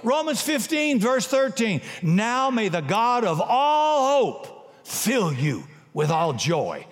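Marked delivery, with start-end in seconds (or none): 2.0-6.9 slow, raised preaching; preached blessing. Real, not acted. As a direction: proclaim the blessing slowly.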